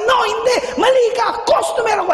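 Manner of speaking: very high-pitched angry shouting; going at him. Retold or imitated. Customer going at the apologizing guard, enraged. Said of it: "I'm just going at him".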